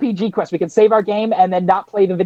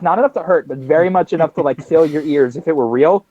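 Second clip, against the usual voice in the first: ordinary delivery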